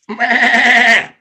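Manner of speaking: loud, high goat bleat; long wavering "meh" like a goat. Acted. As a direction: bleat it out loudly. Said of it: "The goat looks at you and goes".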